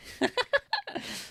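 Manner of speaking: real high laugh; high burst of laughter. Real, not acted. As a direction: crack up laughing. Laughs at own misreading.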